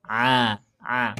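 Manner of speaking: "A" dengan tenggorokan tengah disempitkan; ain sound. Shown as a demonstration. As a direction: tekan suara dari tenggorokan. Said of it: "sempitkan tenggorokan". (ain dari tenggorokan tengah)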